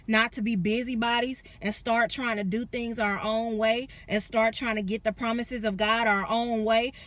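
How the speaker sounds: usual speaking voice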